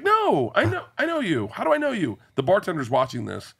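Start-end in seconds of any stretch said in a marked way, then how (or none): 0.0-2.2 high, eager female voice; excited woman fan. Retold or imitated. Woman at the airport lounge asking him, high and eager. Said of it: "She was like".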